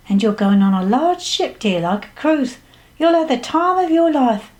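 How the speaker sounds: psychic promising good times, pitch swooping up; fortune-teller. Acted. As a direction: voice the psychic with big rising swoops. Psychic foretells a cruise.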